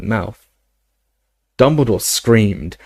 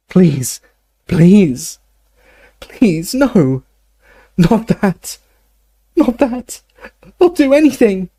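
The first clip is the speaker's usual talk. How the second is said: high anguished pleas, climbing higher; desperate begging; 10 semitones higher, 4 dB louder, slower than usual. Acted. Dumbledore pleading against the next goblet, high desperate cries. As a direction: beg higher and higher.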